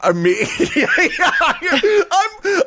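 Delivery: high, giggling through the words; laughing. Real, not acted. To friend, teased about being a meat shed, laughing.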